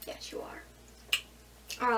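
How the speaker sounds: slow, unvoiced whisper to a pet; whisper to the cat. Real, not acted. Whispering to her cat Magic as it pushes her off the bed. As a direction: breathe it softly at the cat.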